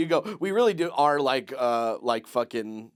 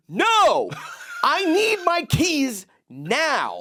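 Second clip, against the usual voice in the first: desperate plea; 10 semitones higher, 4 dB louder, slower than usual. Retold or imitated. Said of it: "and I go".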